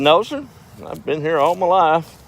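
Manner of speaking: put-on old farmer voice.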